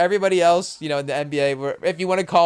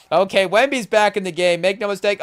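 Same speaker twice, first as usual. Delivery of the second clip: normal talking voice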